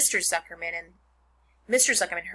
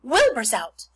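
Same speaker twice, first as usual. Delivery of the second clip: excited announcement; 4 semitones higher, 8 dB louder than usual. A narrator's reading. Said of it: "the horses had caught on to what was happening".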